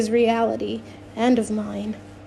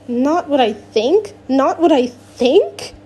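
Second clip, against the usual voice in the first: incredulous anger; 3 semitones higher, 5 dB louder than usual. Acted. Alex incredulous, rising anger.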